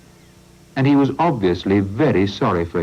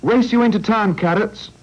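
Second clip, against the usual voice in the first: cried out; 12 semitones higher than usual. Acted. Sancho calling to Carrots to race into town, eager and higher. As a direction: challenge a friend to a race. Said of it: "cried Sancho".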